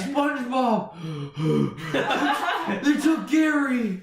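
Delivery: high, gasping cartoon panic; hammed-up breathless alarm; Patrick. Beaten friend brings news of Gary, gasping cartoon voice. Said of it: "And he says".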